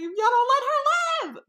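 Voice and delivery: high, loud protective yell; mock outraged shout. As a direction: yell it as an exaggerated threat. Acted threat defending her friend.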